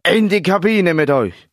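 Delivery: loud, high, angry command; irate coach's bark; growled order. A narrator's reading. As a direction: order them off sharply. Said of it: "knurrte der Trainer".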